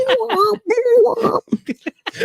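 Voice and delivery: squealing burst of laughter; high laughing fit. Real, not acted. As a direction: breaks into helpless laughter.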